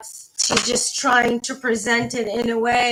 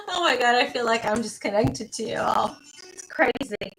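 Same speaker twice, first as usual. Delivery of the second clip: normal talking voice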